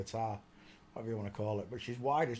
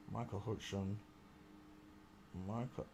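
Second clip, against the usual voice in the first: ordinary delivery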